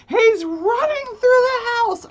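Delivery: husband shouting in a panic from the kitchen; panicked yell. Retold or imitated. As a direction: yell the alarm across the house. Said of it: "I hear him go".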